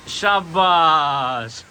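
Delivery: very slow, stretched cheer; long, lingering well done; drawn-out praise. Real, not acted. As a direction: stretch the word of praise right out. Cheering on a seed throw.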